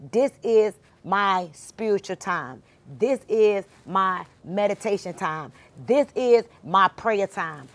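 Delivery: slow, measured affirmation, each word set down; unhurried spoken affirmation; slow deliberate declaration. Shown as a demonstration. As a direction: say it like a vow you mean. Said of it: "go into it intentionally".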